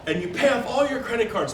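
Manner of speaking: ordinary talk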